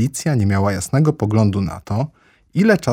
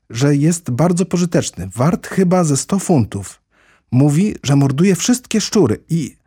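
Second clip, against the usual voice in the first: higher-pitched, carried-away enthusiasm; eager gushing; 6 semitones higher, 3 dB louder than usual. A narrator's reading. Alice praises a neighbour's dog to the mouse, eager. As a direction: rattle on with mounting eagerness. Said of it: "Alicja gorliwie ciągnęła dalej".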